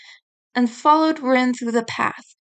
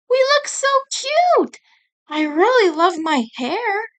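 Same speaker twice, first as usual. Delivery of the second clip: put-on much higher, giddy girl voice; girly delight; 9 semitones higher than usual. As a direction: gush over your new look. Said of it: "Tomoko said".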